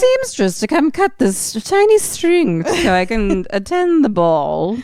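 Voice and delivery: high, loud fussy socialite; posh lady voice. Retold or imitated. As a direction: play a spoiled gala guest in a panic. Mocking a posh gala customer.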